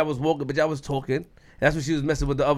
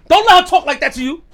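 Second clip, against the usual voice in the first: higher, louder hype, pushing his friend to fire back; loud egging on; 10 semitones higher, 7 dB louder than usual. Real, not acted. Urging friend to answer back.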